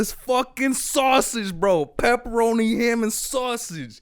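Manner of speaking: high, strained, exasperated reenactment; loud. Retold or imitated. Customer insisting on sausage to pizza guy, loud and exasperated. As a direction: drag out each topping at high volume. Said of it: "so it's not as loud".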